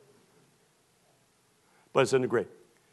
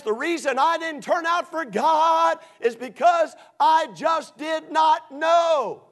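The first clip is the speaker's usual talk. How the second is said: excuser; 13 semitones higher, 5 dB louder, slower than usual. Retold or imitated. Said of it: "Somebody says".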